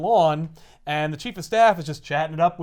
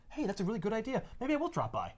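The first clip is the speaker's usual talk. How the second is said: high, soft acted villain reply; mock-agreeable; 5 semitones higher, 9 dB quieter than usual. Villain accepting the invitation, high and mock-agreeable. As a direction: accept lightly, pitched up and quiet.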